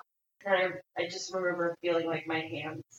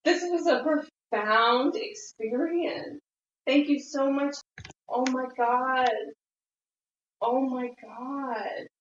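emotional; 6 semitones higher, 6 dB louder than usual. Real, not acted. Moved thanks after the experience.

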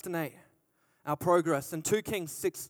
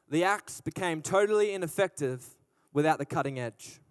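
ordinary delivery

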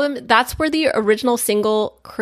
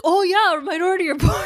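excited exclaim; 10 semitones higher, faster than usual. Retold or imitated. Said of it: "so I was like".

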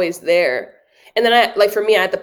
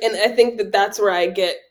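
normal talking voice

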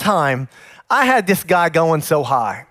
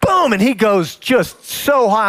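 ordinary talk

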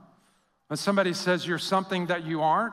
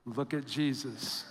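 much softer, hushed answer; 6 semitones lower, 9 dB quieter than usual. Acted. Preacher answering his own question, hushed. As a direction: say it hushed and sure. Sermon refrain after question.

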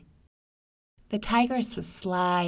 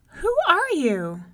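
put-on much higher, wary child voice; little girl asking; 11 semitones higher, 5 dB louder than usual. May May asks the stranger at the window, high and wary. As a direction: ask like a cautious small girl. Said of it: "asked May May".

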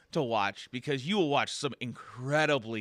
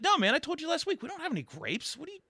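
exasperated bartender; faster than usual. Acted.

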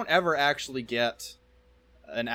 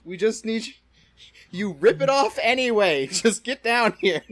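high, loud, pleading shout; mock exasperated yelling; 9 semitones higher, 8 dB louder than usual. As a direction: holler up at someone in hammed-up exasperation. Acted team pleading with Mamoru.

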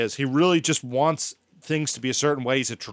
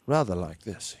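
posh English accent; 7 semitones lower, 5 dB quieter than usual. Shown as a demonstration. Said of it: "we're doing all these shitty accents".

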